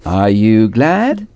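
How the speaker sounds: Are you glad? lent, chaque mot détaché; slow model. Shown as a demonstration. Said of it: "es-tu content ou contente?". (modèle lent : Are you glad? mot par mot)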